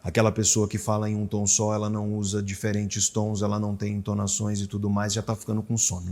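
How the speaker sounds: low, soft and droning on one note; monotone voice. Shown as a demonstration. Describing the boring audiobook narrator in a soft monotone. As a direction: drone like a dull audiobook narrator. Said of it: "monótona".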